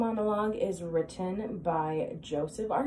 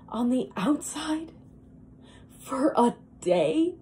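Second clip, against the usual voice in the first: higher-pitched, halting wistful longing; Linda; 5 semitones higher than usual. Acted. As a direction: dream aloud, lingering over the wish. Linda monologue, dreams of beauty.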